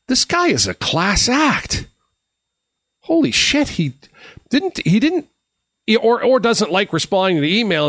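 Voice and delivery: high, amazed rush of disbelief; shocked inner thought. Retold or imitated. Surprised he answered his email so fast, high and amazed. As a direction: replay the moment you realised he called back. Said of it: "'cause I thought".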